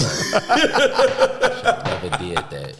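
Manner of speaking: high laughing regret. Retold or imitated. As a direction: laugh through the words.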